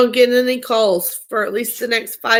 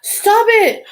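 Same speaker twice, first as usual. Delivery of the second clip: playful scold; 11 semitones higher, 6 dB louder than usual. Real, not acted. Scolding through laughter.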